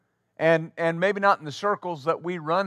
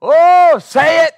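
church shout; 10 semitones higher, 11 dB louder, slower than usual. Retold or imitated. Congregation calls out approval.

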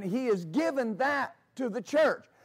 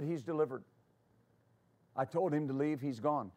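soft-spoken Lawrence; 5 semitones lower, 7 dB quieter than usual. Retold or imitated. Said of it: "Lawrence is very soft-spoken".

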